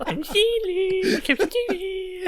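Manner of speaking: very high, slow, goofy strained voice; Adam Sandler impression. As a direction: squeal it out like a manchild. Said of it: "Adam Sandler".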